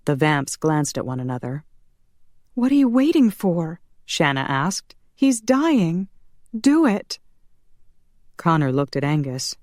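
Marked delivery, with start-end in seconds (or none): 2.5-3.8 high, impatient woman's voice; urgent demand. Acted. Daughter urges them to act. 5.1-7.2 high, pressing command; desperate urging. Acted. Daughter urges saving her father.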